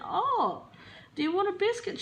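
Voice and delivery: high doting mum voice; imitating mum. Mum asking dog what it wants, high cooing. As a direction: coo at the dog like a fond mother. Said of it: "mum went".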